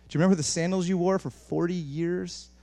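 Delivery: ordinary talk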